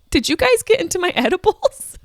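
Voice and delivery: high-pitched, disbelieving question; astonished mock squeak at the kids; mock incredulity. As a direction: ask it in high, comic disbelief. Mock-incredulous reply to children's wisdom.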